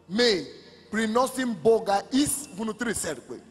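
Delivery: ordinary talk, nothing marked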